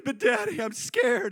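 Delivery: put-on high, scared little-girl whine; mock tearful child. Scared daughter pleading with dad, high mock-tearful voice. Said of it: "and she started crying".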